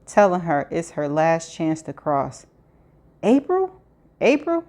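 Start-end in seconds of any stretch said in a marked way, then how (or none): 3.2-4.6 hopeful high call. A narrator's reading. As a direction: call out in disbelief. Calling out to a glimpsed friend.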